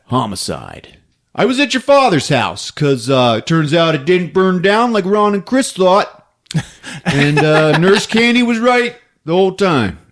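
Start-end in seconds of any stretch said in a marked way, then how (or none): none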